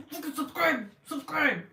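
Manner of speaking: high growly command; mock-angry order. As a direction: playfully demand it in a harsh voice. Mock-aggressive order to subscribe.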